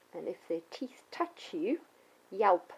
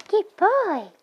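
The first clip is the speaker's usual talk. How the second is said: much higher, louder praise voice; 11 semitones higher, 11 dB louder than usual. Shown as a demonstration. Trainer demonstrating praise to the dog, high pitched. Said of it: "and the praising".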